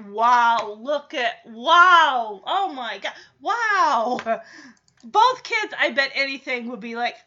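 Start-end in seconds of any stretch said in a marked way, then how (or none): 0.1-4.4 loud, wide-eyed wows; amazed kid voice. Retold or imitated. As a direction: gasp in wonder at everything. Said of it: "his eyes are like".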